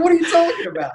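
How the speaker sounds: very high-pitched mock disbelief; incredulous. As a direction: squeal it as a retold, baffled protest. Retells disbelief at supervisor's criticism.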